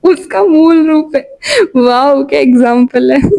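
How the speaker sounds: laughing. Real, not acted. Laughing at own example.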